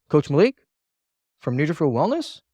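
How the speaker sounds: "Malik" and "Wellness" rising, as if already known; questioning intonation. Shown as a demonstration. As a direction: lift "Wellness" expecting to be recognized. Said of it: "you should already know who I am".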